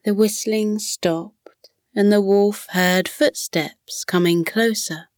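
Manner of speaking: plain reading voice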